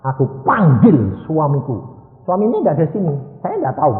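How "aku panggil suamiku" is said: high, loud imitated cry to God; imitated woman's fervent shout; crying out in faith. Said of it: "Dia teriak manggil suaminya".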